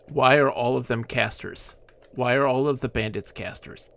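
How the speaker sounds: ordinary delivery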